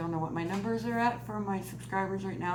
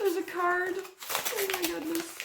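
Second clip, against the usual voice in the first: high-pitched, overwhelmed by happiness; touched to the point of real tears; near tears; 11 semitones higher than usual. Real, not acted. Vlogger finding a card in her gift, tearfully moved. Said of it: "I'm not crying".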